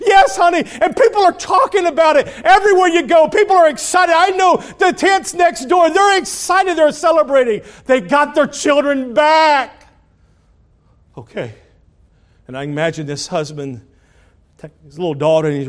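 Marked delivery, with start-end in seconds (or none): 0.0-9.9 high, loud, near-shouting good news; excited mother. Acted. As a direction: spill over with joy. Mother tells of celebrating tents.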